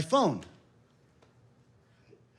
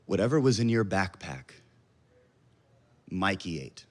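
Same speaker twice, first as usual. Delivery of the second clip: calm; 8 semitones lower than usual. Retold or imitated. Wife on the phone reports the dog ate the drugs, icy calm. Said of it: "in the most calm manner".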